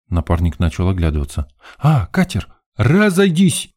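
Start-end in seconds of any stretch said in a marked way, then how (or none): 1.8-3.7 high-pitched shout to clear the way; sudden excited holler; yelling. A narrator's reading. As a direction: call out, scattering a crowd. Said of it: "заорал Жека".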